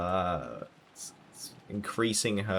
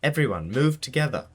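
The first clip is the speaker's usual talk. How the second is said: calling out; 7 semitones higher, 3 dB louder than usual. Acted. Battler calling to group.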